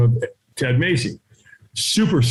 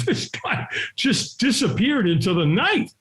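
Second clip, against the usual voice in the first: ordinary talk, nothing marked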